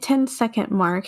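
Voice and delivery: usual speaking voice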